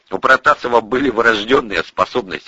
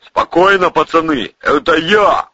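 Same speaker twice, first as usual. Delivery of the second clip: loud, raised-pitch call; hearty shouted reassurance; shouted reply; 8 semitones higher, 5 dB louder than usual. A narrator's reading. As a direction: call up to the guards. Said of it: "крикнул Протасов".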